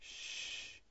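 breathy unvoiced shush; whispered shush. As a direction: hush the room in a whisper. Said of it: "hushing the listeners".

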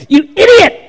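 high-pitched, loud mock outburst; furious GPS. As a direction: shriek it like a GPS losing its temper. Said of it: "It doesn't get mad at me".

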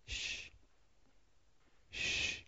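soft shush to Peter; quiet him gently; hushing. Pretend. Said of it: "Now, Peter".

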